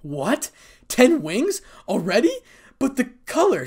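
high, rising disbelieving questions; surprised. A narrator's reading. Irina seeing Issei's ten wings, surprised and incredulous. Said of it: "said Irina in surprise".

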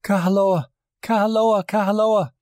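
very high, repeated cries of a name; high hysterical calling, short of full volume; acted screaming. A narrator's reading. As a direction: cry out hysterically, pitched far up. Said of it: "Hione and Kai Kai and Luana were screaming".

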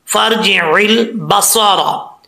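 फर्जिल बसारा recited slowly, फा joined straight to रा with no अलिफ; slow recitation. Shown as a demonstration. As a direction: chant फर्जिल बसारा slowly, dropping the alif. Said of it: "बीच में जो अलिफ है ये गिर जाएगा". (फर्जिल बसारा धीरे, फा को सीधे रा से मिलाकर)

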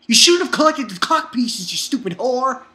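loud, high-pitched mock outburst; mock scolding. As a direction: yell it like an exasperated heckler. Mock scolding of a character's revelation.

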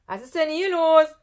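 loud, high woman's shout; indignant high-pitched call. Acted. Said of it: "rief eine Frauenstimme".

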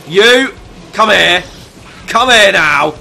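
loud, high-pitched chef's command; slow, forceful angry call; angry summons. Pretend. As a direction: shout orders at a useless underling. Chef ordering game enemies over.

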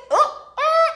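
very high, loud squeals; excited squealed ooh. Acted. Teacher imitating Miss Jennings when very excited, high squeals. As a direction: squeal in two piercingly high bursts. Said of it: "when she's very excited".

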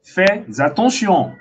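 slow "Fais attention", said fay-za-ton-sion; liaison with Z. Shown as a demonstration. Teacher demonstrating the liaison, slow "Fais attention" with a Z. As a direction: draw out "Fais attention", sounding a Z into "attention". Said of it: "Você tá fazendo Z".

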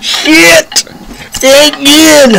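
replaying a stunned yell while watching with dad; shocked outburst. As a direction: relive the shock of seeing it happen, yelling. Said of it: "we're like".

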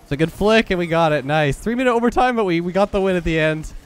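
excited win. Real, not acted. Player telling viewers of an overtime win, excited. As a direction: celebrate the overtime win, voice lifted and loud. Winning goal after long overtime.

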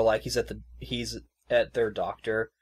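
ordinary delivery

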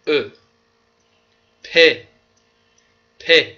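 each French letter read out loud and clear, with a pause after each; French alphabet drill. Shown as a demonstration. Teacher drills the French alphabet letter by letter for students. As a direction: spell out the French letters slowly for the class to repeat.

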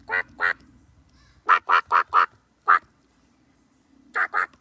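loud low quacks; duck quacking. Pretend. Librarian showing kids a duck, loud quacking.

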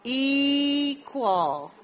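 equal drawn out in clear English, falling at the end; slow English model word. Shown as a demonstration. Teacher modelling a word for students, drawn out. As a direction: model equal slowly for the class. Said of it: "la palabra de dos sílabas".

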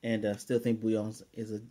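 ordinary delivery

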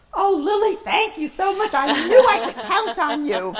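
very high, loud, gushing thanks; imitated happy relief. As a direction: act out a girl suddenly overjoyed and grateful. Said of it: "and walked out happy".

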